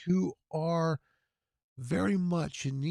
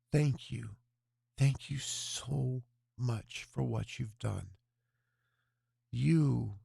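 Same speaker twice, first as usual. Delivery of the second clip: prayer; 2 semitones lower than usual. Acted. Host praying to God over the fundraiser total, reverent prayer. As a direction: pray it earnestly, lingering on "Lord".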